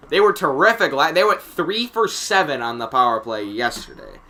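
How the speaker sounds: ordinary talk, nothing marked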